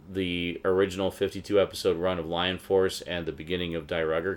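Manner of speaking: normal talking voice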